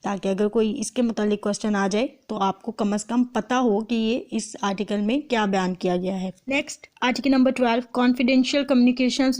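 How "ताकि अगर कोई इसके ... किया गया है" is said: ordinary delivery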